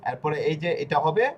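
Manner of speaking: ordinary talk, nothing marked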